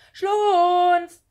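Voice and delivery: loud, high, drawn-out call; searching call on one held high note. A narrator's reading. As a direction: shout the name long and high. Boy running outside calling his missing friend.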